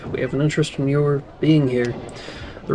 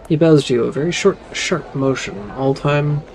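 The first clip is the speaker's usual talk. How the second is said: ordinary talk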